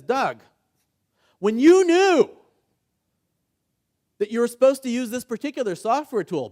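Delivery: loud, high, slow scolding, piling on the blame; exasperated boss. Retold or imitated. Boss scolding an employee, mock exasperated shout. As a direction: let the frustration boil over at the end. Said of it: "opinion and judgment built into the question".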